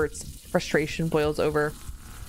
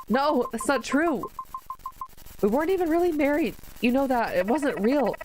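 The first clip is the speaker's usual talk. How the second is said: higher, rushed, defensive denial; flustered denial; 10 semitones higher than usual. Acted. Protagonist denying she cheated on Kazuki, flustered high protest. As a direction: protest that it wasn't real.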